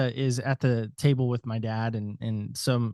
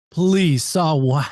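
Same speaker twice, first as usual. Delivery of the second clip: louder, pushing him to spit it out; impatient and raised, demanding an answer; 4 semitones higher, 6 dB louder, much slower than usual. Retold or imitated. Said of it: "just spit it out".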